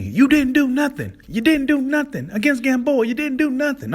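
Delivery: high, loud mimicked taunting; mocking taunt. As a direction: jeer it high, like an insistent heckler. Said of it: "Back when, um, Devin Haney".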